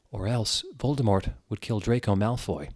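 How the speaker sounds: ordinary talk, nothing marked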